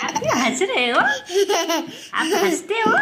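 laughing through the words. Real, not acted. Mother laughing as she asks her giggling child. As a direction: giggle along while asking.

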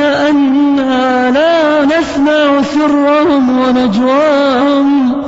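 slow, sung recitation of the verses; Quran recitation. Acted. Preacher closes with slow chanted Quran verses. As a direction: chant each verse slowly and melodically.

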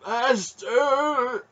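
very high, strained croak; croaking plea. A narrator's reading. Burning man begging his master, croaking. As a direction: beg in a strangled voice. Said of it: "he croaked".

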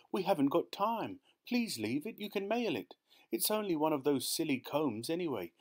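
high, urgent pleading; pleading cry. A narrator's reading. Wife begging husband to leave the present, pleading cry. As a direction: beg him, running out of time. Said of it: "she cried".

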